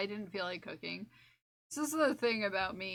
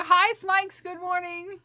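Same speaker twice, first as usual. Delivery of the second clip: cheery greeting; 9 semitones higher, 8 dB louder, slower than usual. Real, not acted. Bright greeting to a friend.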